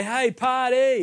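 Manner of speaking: high, drawn-out pretend cheer; mock party cheer. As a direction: stretch it out like a hammed-up party call. Imagined party greeting acted out.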